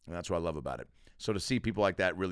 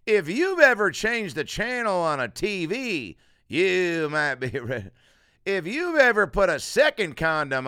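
Foxworthy parody; 5 semitones higher, 5 dB louder than usual. Retold or imitated. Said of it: "you can really add that to anything".

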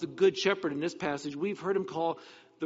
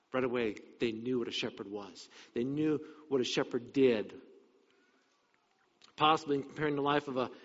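usual speaking voice

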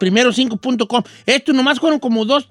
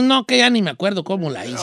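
usual speaking voice

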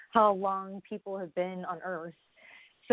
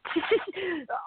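high laugh; 11 semitones higher than usual. Real, not acted. Laughing at the distracting text.

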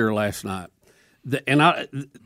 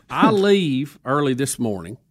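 ordinary talk

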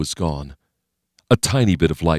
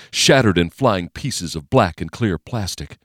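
usual speaking voice

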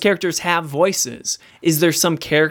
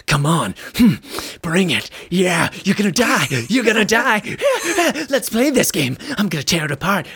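taunting gym-brute voice, pitched up toward the end; mock cage fighter taunting the game, laughing madly; snarling brute playtester; 2 semitones higher than usual. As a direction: goad them like a caged fighter itching to brawl. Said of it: "he's the one that's pacing like a puma and drooling".

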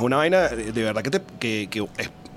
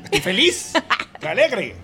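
higher, mock-defensive cheer; 6 semitones higher than usual. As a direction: play up being happy, not angry. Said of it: "yo hablo así".

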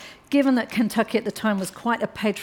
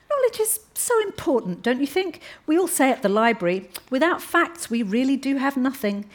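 put-on higher, sweetly pointed young woman at the dinner table; a higher, overly polite voice that needles the men; Alice, polite but pointed; 6 semitones higher than usual.

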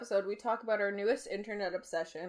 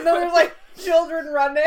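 laughing shout; 5 semitones higher, 13 dB louder than usual. Real, not acted. Laughing retelling the Vine.

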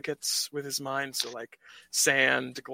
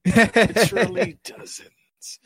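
laughing out the words, louder and slow; 8 semitones higher, 12 dB louder, slower than usual. Real, not acted. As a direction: crack up while saying it, slowly. Laughing at the tiny-audience joke.

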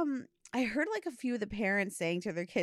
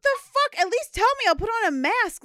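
high, loud, outraged shout; outraged yell; 13 semitones higher, 7 dB louder than usual. Retold or imitated. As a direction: shriek it in disbelief. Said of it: "And I was like".

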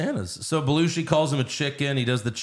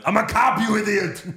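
put-on higher, louder Austrian-accent line; Arnold impression; 9 semitones higher, 4 dB louder than usual. Podcaster imagines Arnold's perfect line in an Austrian accent. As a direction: deliver it as a smug Arnold. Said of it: "if he went".

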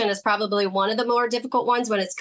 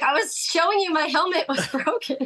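higher-pitched retold protest, breaking into a laugh; mock protest; 8 semitones higher than usual. Co-host mimicking the player's complaint about his helmet, laughing mock protest. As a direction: quote an aggrieved player, amused.

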